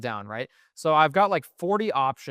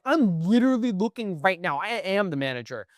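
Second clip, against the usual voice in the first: higher, indignant mock sass; sassy snap; 5 semitones higher than usual. Manager snapping back at the caller, lightly mocked sass. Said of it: "she snaps back sassy".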